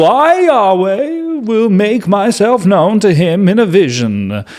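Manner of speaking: high, slow, grand reading voice; dramatic scripture reading. Acted. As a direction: proclaim it like God speaking from the cloud. Reads God's speech dramatically.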